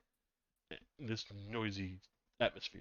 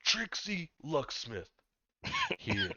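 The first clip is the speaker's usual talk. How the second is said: deep gravelly voice; 8 semitones higher, 4 dB louder, much slower than usual. Acted. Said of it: "this is a much more gravelly voice".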